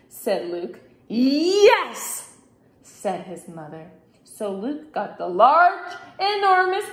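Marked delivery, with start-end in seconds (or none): 1.1-2.4 loud, high, drawn-out yes; emphatic yes. A narrator's reading. Mother confirming the big broom, loud and emphatic. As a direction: pounce on the right guess.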